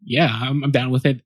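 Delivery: ordinary talk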